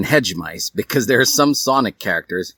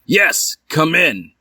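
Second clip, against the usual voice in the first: raised high call, louder; high yell; 8 semitones higher, 4 dB louder, slower than usual. Acted. Edward calling the visitor in, a high yell. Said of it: "yelled Edward".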